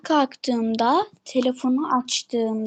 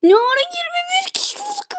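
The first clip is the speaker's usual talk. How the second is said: surprised. Real, not acted. Surprised at viewer jump.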